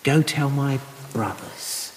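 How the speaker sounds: slow, soft, savouring each word; soft and slow, almost tender; tender emphasis. Shown as a demonstration. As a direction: linger lovingly on the phrase. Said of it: "what a lovely word that is".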